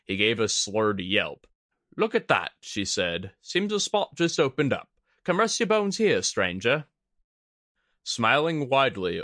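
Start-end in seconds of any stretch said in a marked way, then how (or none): 2.0-2.5 higher, playful woman; coy and inviting. Acted. Kudaka voiced. 3.4-6.9 inviting woman voice. Acted. Kudaka inviting.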